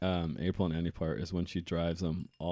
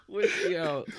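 high squeaky laughter; laughing; 12 semitones higher, slower than usual. Real, not acted. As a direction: laugh through the words, high. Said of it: "my horrible laugh".

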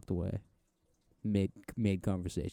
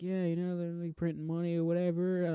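high-pitched chatter, imitating a man; mock high-voiced small talk; imitated small-talker; 9 semitones higher than usual. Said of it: "and he's like".

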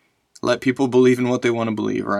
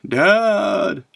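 imitated child's high wail for Dad; mock child calling out in the night; crying child's call; 8 semitones higher, 3 dB louder than usual. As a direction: mimic a kid's long, high call. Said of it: "then I heard it again".

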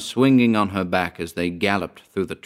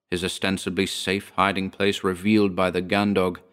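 ordinary delivery

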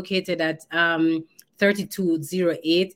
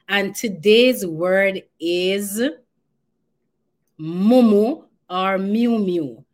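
slow, loud announcement of the word; dramatic reveal; 6 dB louder, slower than usual. Real, not acted. Host unveils the Patois word of the day, slow and loud. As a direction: draw out the big reveal.

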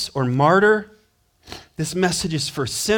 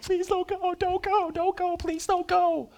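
high, pleading, mock-whiny plea; pleading crowd; 5 dB quieter than usual. People begging Paul not to leave, high mock pleading. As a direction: beg in a high voice, hammed-up. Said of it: "all the people like".

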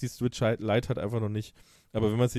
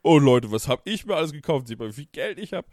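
angeberisch; 3 semitones higher, 8 dB louder than usual. Retold or imitated. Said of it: "weil ich jetzt hier so".

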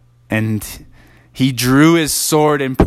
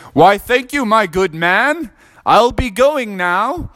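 higher, slower mock-polite voice; Froggy playing fancy, higher and slow; mock-posh Froggy; 9 semitones higher than usual. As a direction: thank him like a pompous gentleman. Froggy thanks the knight grandly.

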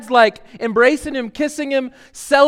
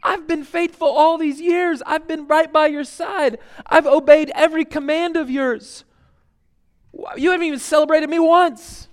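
put-on higher-pitched complaining older brother; aggrieved older brother; 8 semitones higher than usual. Preacher voicing the older son complaining to his father, higher and aggrieved. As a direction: whine out his grievance, pitched up. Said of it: "He's like".

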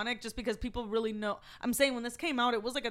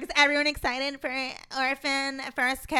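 higher, mock-eager question, slowing at the end; mock excited announcement; 4 semitones higher than usual. Host quoting their own Instagram post, mock excited. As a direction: hype it up, stretching out the title.

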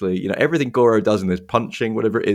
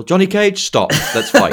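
higher, louder hammed-up challenge; playful challenge; 11 semitones higher, 5 dB louder than usual. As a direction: call out a duel with comic glee. Voicing Scorpion challenging Johnny.

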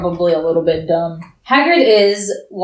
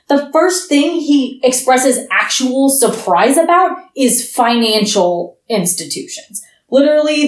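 ordinary delivery

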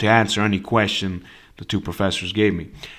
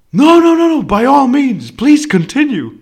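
loud, high-pitched imitation of the professor; eager urging; 14 semitones higher, 8 dB louder than usual. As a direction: excitedly wave the student on, hammed-up.

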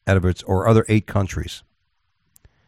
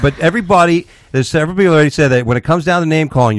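heated and loud; 7 semitones higher, 5 dB louder than usual. Real, not acted. Heated complaint.

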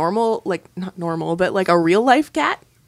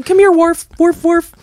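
loud, high goofy dog owner calling; dumb dog voice; 9 semitones higher, 9 dB louder than usual. Acted. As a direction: call the dog in a dopey loud voice. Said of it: "That's my dumb dog voice".

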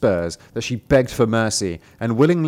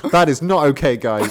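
mock outrage; 3 semitones higher, 6 dB louder than usual. Mocking co-host's outrage.

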